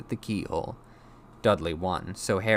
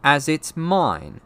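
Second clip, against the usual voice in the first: slow, loud, raised protest; furious insistence; 8 semitones higher, 8 dB louder, much slower than usual. A narrator's reading. Boy claiming his own letter, slow furious protest. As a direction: press it home angrily. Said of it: "said Harry furiously".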